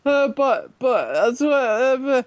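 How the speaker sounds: high-pitched mock sputtering; flustered stammering. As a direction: imitate someone failing to get a word in. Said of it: "your character just sits and goes".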